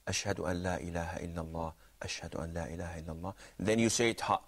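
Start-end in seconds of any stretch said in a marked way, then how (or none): 0.1-3.3 soft, low and quick, barely carrying; hushed demo voice. Shown as a demonstration. Teacher showing the class how tarji' begins, hushed. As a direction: say it only for those next to you. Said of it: "whispering voice".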